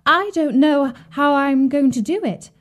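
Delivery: put-on high, innocent girl's voice; feigning little girl. A narrator's reading. Gretel sees through the trick, feigning innocent helplessness. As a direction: play helpless, pitched high and questioning.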